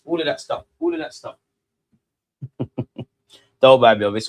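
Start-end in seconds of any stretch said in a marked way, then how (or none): 0.8-1.4 hushed repeat; whisper. Shown as a demonstration. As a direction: breathe it out as a whisper. Repeated line, second whispered.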